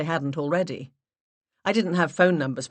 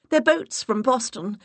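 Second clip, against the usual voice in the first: an octave up, stressing their; flustered self-correction, pitched high; higher, louder, ditzy; high put-on voice correcting herself; 7 semitones higher, 4 dB louder than usual. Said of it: "I raised my voice an octave".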